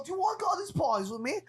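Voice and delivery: high, quick British voice; British invitation. Retold or imitated. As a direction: tempt him like a party boy. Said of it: "He's all".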